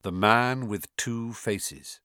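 ordinary delivery, nothing marked

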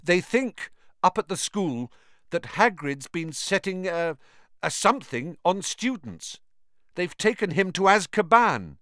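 high, nervous, trying to sound calm; forced calm. A narrator's reading. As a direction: hold steady through fear. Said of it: "as calmly as he could".

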